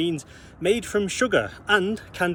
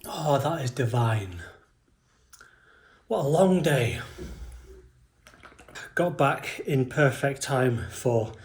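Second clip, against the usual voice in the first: relieved; 4 semitones lower, slower than usual. Real, not acted. First drink after the fast.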